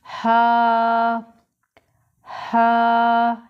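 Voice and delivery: हा voiced from the throat; throaty ha. Shown as a demonstration. Teacher demonstrates the throat हा to students. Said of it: "ये गले से अदा होगा".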